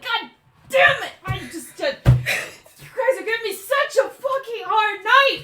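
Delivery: high, loud furious rant; hammed-up shrill exasperation; exasperated character outburst. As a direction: burst out at your pursuers, fed up. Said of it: "a very familiar voice, just like".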